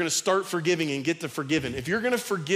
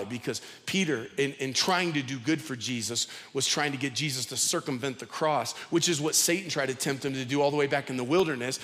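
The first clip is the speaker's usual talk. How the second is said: ordinary talk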